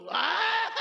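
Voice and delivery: high mock wail of a weeping mother; loud, hammed-up mother's cry. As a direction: let out an imitated wail. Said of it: "अब माँ रोने लगी".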